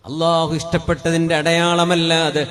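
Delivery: loud, ringing sermon voice; preaching at full force. Acted. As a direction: proclaim it to the whole hall. (ഉച്ചത്തിലുള്ള പ്രഭാഷണം)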